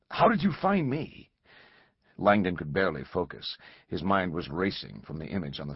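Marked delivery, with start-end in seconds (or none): 0.1-1.3 alarmed. Acted. Langdon shaken, demanding.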